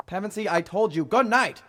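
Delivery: rising to a loud, high dismissal; exasperated, building to a shout; exasperated call. Acted. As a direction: call back over your shoulder, fed up. Calls back at supposed butler following.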